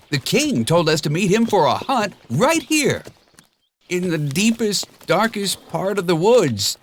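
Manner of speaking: high, quick chattering donkey; excitable donkey. A narrator's reading. Donkey insisting to Shrek they're not lost, high and quick. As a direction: babble nervously.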